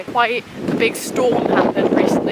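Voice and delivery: loud and strained against a storm wind; shouting over wind. Real, not acted. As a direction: raise your voice to beat the wind outdoors. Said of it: "You're not gonna hear a word I say".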